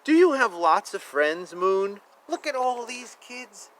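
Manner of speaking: usual speaking voice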